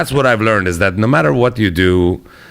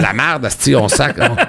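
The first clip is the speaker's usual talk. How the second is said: swearing in Quebec French, mock outrage, pitched a bit higher; 5 semitones higher than usual. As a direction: curse like a fed-up Québécois. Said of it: "I'm like".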